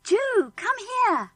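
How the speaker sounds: a high urgent call. Acted. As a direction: call him over urgently. George calling Julian in tunnel.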